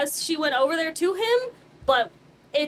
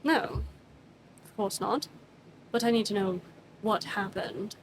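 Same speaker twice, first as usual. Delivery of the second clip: low, quiet, firm questioning; lightly voiced calm stern interrogator; 4 semitones lower, 3 dB quieter than usual. Arminius questioning Cyrus, quietly firm. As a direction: press for answers without raising the voice.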